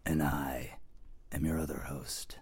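low, soft whispery trailer voice; movie trailer voice. Pretend. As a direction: drop it low and growl softly. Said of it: "I'm trying on a new voice".

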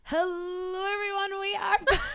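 high, shouted greeting; loud announcement. Shown as a demonstration. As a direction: call out to everyone. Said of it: "let me do that again, but not as loud".

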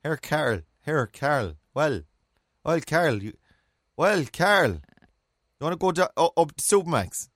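broad Mayo call to a friend; Mayo accent. Shown as a demonstration. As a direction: put on a rough Mayo accent, hailing someone. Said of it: "A Mayo accent".

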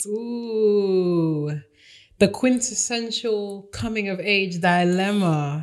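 high, slow, relishing; titillated. Real, not acted. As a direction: savour each word with glee. Savouring the listener's dilemma.

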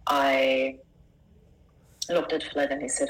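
normal talking voice

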